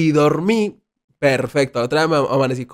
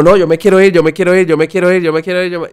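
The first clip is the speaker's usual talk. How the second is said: louder, higher, repeating it like a frantic plea; mock desperate pleading; 5 semitones higher, 6 dB louder, faster than usual. Retelling begging his friend to leave the party, loud mock pleading. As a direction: replay a panicky begging to go home.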